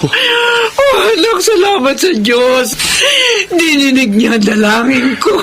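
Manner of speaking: laughing and crying at once; a mother crying with relief; tears of joy. Acted. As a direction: thank God through happy sobs.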